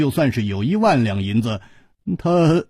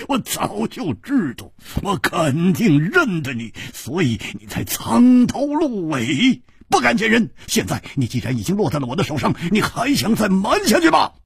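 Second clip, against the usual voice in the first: high, crowing challenge; exultant accusation rising to a demand; gloating hero, triumphant; 9 semitones higher than usual. Acted. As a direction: taunt the unmasked foe, pitch riding high.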